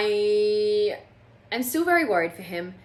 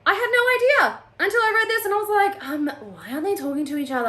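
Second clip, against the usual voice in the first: high, fast, sarcastic squeal; mock surprised; 11 semitones higher, much faster than usual. Real, not acted. Reader mocking her own late realization, sarcastic. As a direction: play dumb, fast and high.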